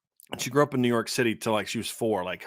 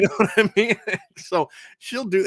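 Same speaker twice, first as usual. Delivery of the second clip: ordinary delivery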